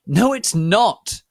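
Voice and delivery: high, loud, slow furious shout; angry shout. Acted. Ebony angrily shouting back at Vampire. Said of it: "I shouted angrily".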